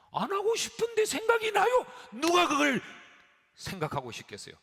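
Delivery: high, loud pleading protest; a worrier shouting that they can't help it; desperate cry. Retold or imitated. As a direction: cry out as the helpless sufferer.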